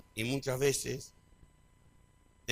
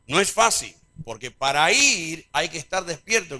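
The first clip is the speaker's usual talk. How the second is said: preaching emphasis; 4 semitones higher, 10 dB louder than usual. Acted. Preacher stressing a point.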